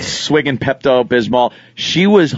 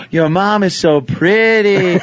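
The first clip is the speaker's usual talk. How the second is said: put-on admiring kids; 5 semitones higher, slower than usual. Schoolkids admiring his mother, high gushing voice. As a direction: imitate awestruck schoolkids, slow and high. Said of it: "kids would be like".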